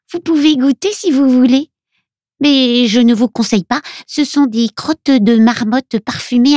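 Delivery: put-on high little-girl character voice; Luna. A narrator's reading. Little girl shows friends her mother's remedies, high child voice. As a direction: explain it all as a small girl, high-pitched. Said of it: "continue Luna".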